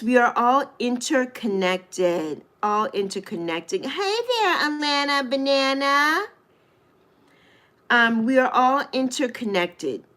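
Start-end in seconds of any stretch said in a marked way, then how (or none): 3.8-6.3 high sing-song hello, last note held; playful greeting. Real, not acted. Livestream host greets a viewer joining the chat, playful sing-song. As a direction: call out a nickname in a high lilt.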